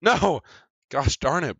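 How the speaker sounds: loud, high outburst; frustrated yell. Real, not acted. Gamer losing a fight, yelling in frustration. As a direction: cry out at a sudden setback.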